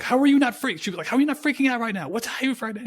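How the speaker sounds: put-on high, fast, panicky girl voice; mock panicked girlfriend. Buddy's girlfriend panicking in retelling, high mock panic. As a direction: squeak it out like she's losing it.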